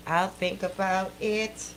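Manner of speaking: ordinary delivery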